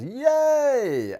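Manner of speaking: high, bright little cheer; cheerful yay. Real, not acted. Teacher cheering a solved sum for the class, high and excited. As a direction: celebrate the answer with a squeal. Celebrating the answer.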